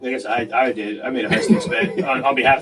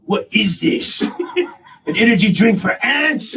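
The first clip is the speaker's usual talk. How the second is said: mock question, laughing through it and higher; laughing mockery; mocking through laughter; 6 semitones higher than usual. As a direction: tease him, barely holding back laughter. Mocking the instructor's tiny drink.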